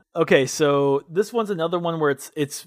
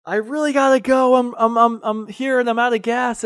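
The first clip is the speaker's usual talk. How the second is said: fake car trouble; 9 semitones higher than usual. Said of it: "pretending like it's running out of gas".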